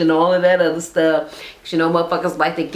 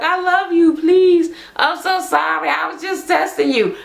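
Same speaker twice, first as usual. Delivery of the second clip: mock pleading girlfriend; 8 semitones higher than usual. Imagined girlfriend begging her man to stay, high and pleading. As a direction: beg him to stay in a high voice. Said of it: "because she like".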